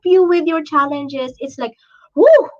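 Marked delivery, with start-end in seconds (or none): 2.2-2.5 high, loud exhale of emotion; overwhelmed whoo. Real, not acted. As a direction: let the feeling burst out. Overwhelmed with gratitude.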